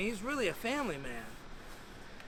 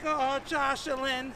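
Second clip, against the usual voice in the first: high, slow motherly lament; knowing mom; 6 semitones higher, slower than usual. Retold or imitated. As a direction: draw out each phrase as his mother. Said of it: "And she's like".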